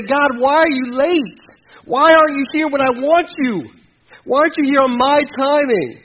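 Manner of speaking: loud, high, slowed complaint at God; accusing complaint. Acted. Believer complaining God is late, loud and accusing. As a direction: demand why, stretched and loud.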